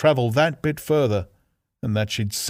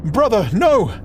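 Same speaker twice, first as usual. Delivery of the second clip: panicked plea; 10 semitones higher, 6 dB louder than usual. Acted. Sister pleading in panic.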